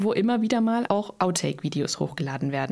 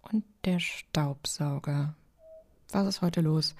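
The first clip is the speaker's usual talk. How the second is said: heiser geflüstert, langsam, Stimme fast weg; raue Flüsterstimme; 3 semitones lower, 4 dB quieter, slower than usual. Real, not acted. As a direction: mit wegbrechender Stimme hauchen. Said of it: "meine Stimme ist weg". (Stimme ist weg, raues Flüstern)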